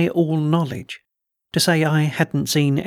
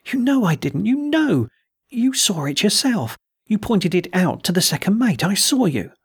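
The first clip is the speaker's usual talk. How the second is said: high, pleading insistence; passionate protest; 6 semitones higher than usual. A narrator's reading. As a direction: insist desperately, pitch leaping up. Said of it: "in a passionate whisper".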